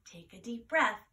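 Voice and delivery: ordinary delivery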